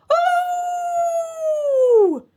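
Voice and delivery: long, piercingly high werewolf howl. A narrator's reading. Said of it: "howled higher than ever".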